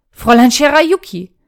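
loud, pressing call; urgent interruption. Acted. Obi cuts in to hold Shirayuki back, loud. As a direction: cut in to stop her.

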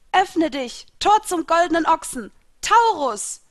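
high, loud summoning shout; commanding cry to summon a spirit; calling out. A narrator's reading. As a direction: proclaim the spell at full voice. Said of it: "rief ich".

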